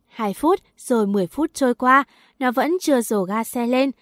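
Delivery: ordinary delivery, nothing marked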